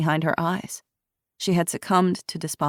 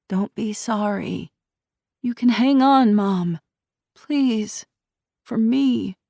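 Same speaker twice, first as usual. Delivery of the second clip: higher, begging daughter; pleading; 6 semitones higher, slower than usual. A narrator's reading. Daughter begging her mother to hang on, pleading.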